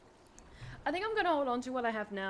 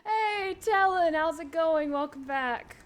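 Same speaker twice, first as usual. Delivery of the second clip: excited greeting; 7 semitones higher, 7 dB louder, slower than usual. Real, not acted. Greeting a returning viewer.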